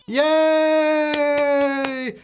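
high delighted yay; excited cheer. Real, not acted. Baby born, cheering.

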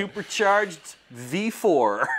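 loud, slow, punched-out boast; laughing, big showy delivery; mock hype. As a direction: announce the winning car like a champion. Hyping the winning car, mock boast.

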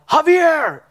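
high, loud call across a field; retold yell. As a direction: yell the name out to a friend. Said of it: "And I yelled".

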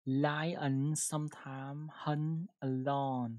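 each word drawn out for learners; very slow model sentence. Shown as a demonstration. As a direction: read it word by word, very slowly. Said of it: "Một câu nào".

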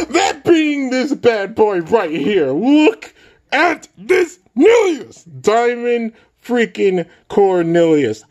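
high, slow, gushing hype; excited. Real, not acted. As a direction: squeal over the prize piece. Showing off the new toy.

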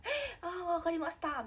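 high, acted-out flustered reply; retold flustered agreement. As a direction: replay being caught off guard and giving in. Acts out reply to urgent work request.

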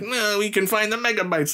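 high, nasal-free squeaky nerd impression. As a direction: pitch way up like a cartoon nerd. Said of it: "when we all do a nerd voice".

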